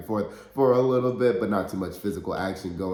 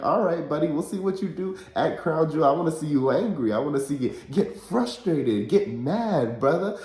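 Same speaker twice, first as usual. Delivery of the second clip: higher, louder pumped-up taunt; hyped wrestling promo; 7 semitones higher, 3 dB louder than usual. Retold or imitated. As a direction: hype him up like a promo guy yelling "brother". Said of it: "I'm like".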